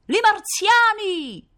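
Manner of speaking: high, slow, drawn-out scream of alarm; panicked shout of I marziani; terrified cry. Acted. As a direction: cry it out to the whole street. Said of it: "poi si udì un grido".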